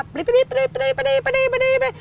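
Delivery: high squeaky bleeps standing in for swearing; censor bleeps. Retold or imitated. Daughter bleeps out her angry dad's swearing, high-pitched mock censor. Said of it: "My dad swore a lot".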